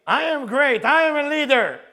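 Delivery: loud, high, puffed-up proclamation; hammed-up proud shout; acted boasting. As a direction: proclaim it grandly, imitating a boaster. Acts out boastful ego.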